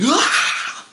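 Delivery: sudden high loud yelp; high loud yelp of pain from a shock. Retold or imitated. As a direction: cry out from the electric jolt. Shocked by electric wire.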